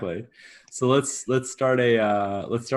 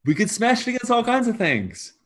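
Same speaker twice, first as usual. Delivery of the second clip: high-pitched, incredulous objection; acted protest; 10 semitones higher than usual. Quartermaster protests to the god.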